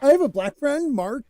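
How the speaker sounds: hammed-up self-satisfied boast; mock proud boast. Shown as a demonstration. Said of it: "that's, like, racist".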